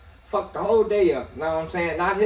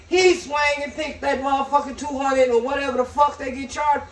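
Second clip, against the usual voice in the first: ordinary talk, nothing marked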